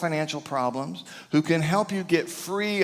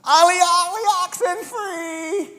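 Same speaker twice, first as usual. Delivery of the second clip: put-on kids' game call; 4 dB louder than usual. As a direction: call it across the playground like a kid. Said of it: "It was this".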